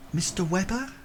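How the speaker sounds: high, questioning lady's voice; polite lady asking with a rising lilt; lady's enquiry. Acted. Lady picking out one of two gentlemen, high questioning voice. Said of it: "she looks at you both and says".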